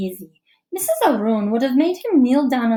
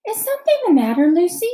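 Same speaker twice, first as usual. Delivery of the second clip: much higher, fast concerned question; concerned teacher; 8 semitones higher, 4 dB louder, faster than usual. A narrator's reading. Teacher asks a worried girl what's wrong, quickly. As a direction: check on her quickly, worried. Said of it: "asks Missus Beam".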